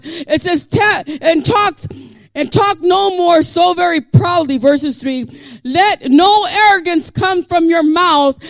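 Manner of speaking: loud, high, rising shouted sermon; full-force preaching. Real, not acted. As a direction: preach it out with fire to the church.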